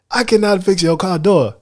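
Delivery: put-on African accent. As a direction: play the skit hero in an African accent. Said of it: "little accents like that".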